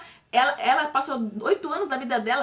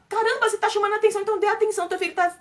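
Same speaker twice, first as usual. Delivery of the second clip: much higher and fast, exasperated; indignant outburst; 9 semitones higher, 4 dB louder, faster than usual. Real, not acted. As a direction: throw it at the parents, fed up. Indignant advice to parents. (indignação, bem mais agudo e rápido)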